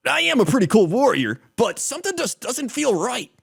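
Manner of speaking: anime hero. Acted.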